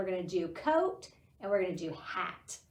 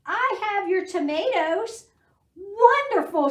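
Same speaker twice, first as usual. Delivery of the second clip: excited quote; 11 semitones higher, 10 dB louder than usual. Acted. Character lines in picture book.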